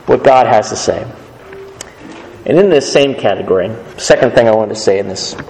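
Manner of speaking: normal talking voice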